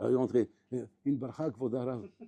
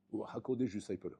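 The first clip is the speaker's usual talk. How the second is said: normal talking voice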